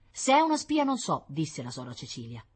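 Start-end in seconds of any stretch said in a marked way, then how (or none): 0.1-1.2 high, loud reply from the wife; firm retort. A narrator's reading. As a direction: concede nothing, answer back sharply. Wife answers husband's agitated accusation. (replica decisa e acuta)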